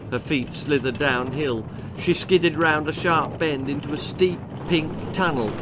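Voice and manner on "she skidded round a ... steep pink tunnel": usual speaking voice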